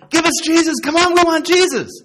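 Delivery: high, loud fan-like shouting; mock crowd clamour. Imagined crowd calling for Jesus, loud mock clamour. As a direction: clamour eagerly like a crowd demanding its favourite.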